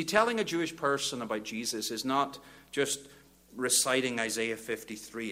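ordinary talk, nothing marked

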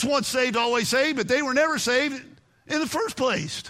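fervent preacher building up; preaching. Acted. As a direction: preach it with fire. Preaching at full force.

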